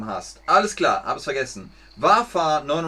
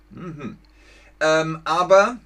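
normal talking voice

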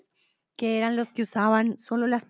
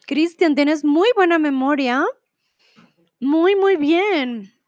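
enthusiastic praise; 4 semitones higher, 5 dB louder than usual. Real, not acted. Praising a student.

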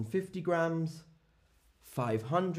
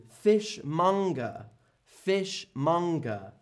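slow, careful teacher's pronunciation of fishmonger; drawn out fishmonger for learners to copy; slow model word; 3 semitones higher than usual. Shown as a demonstration. Teacher modelling fishmonger slowly for the class. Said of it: "This is the fishmonger".

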